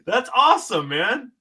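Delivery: high, laughing and incredulous; giddy real laughter through the words; laughing disbelief. Real, not acted. A fan laughing in disbelief at a sword named after him. Overwhelmed by the tribute.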